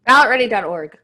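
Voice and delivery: words pushed out round a pen; loud plug with a pen in mouth; pen in teeth. Real, not acted. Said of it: "nice job with the, with the pen in your mouth".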